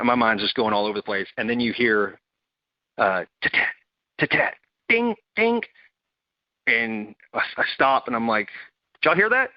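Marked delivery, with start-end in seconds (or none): 3.4-5.6 quick rattling ta-tat then high pinging dings; imitated gunfire pings. Imitates gunfire hitting truck. 8.9-9.5 high, startled call to the others; sudden alert, ears pricked; alarmed question. Retold or imitated. Alarmed at sniper sounds.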